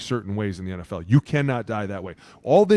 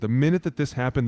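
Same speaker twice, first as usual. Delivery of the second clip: usual speaking voice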